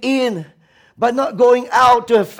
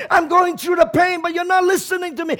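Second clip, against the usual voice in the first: raised, pitched-up lament at God; abandoned cry; 8 semitones higher, 3 dB louder than usual. Retold or imitated. Preacher voicing despair to God on Good Friday, raised. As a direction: cry out to God as one forsaken. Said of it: "it's like".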